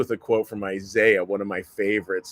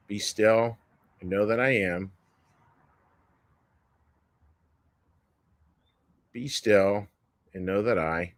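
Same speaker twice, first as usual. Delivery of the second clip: guided meditation; 3 dB quieter than usual. Acted. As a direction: let each line fall away into the silence. Leads a meditation.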